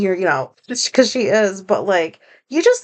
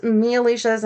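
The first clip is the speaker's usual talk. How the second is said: usual speaking voice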